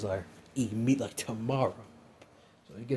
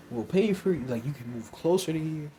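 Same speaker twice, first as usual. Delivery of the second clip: higher and louder principal voice; eager offer, pitched up and louder; Nezu's voice; 4 semitones higher, 4 dB louder than usual. Acted. Nezu voiced offering help.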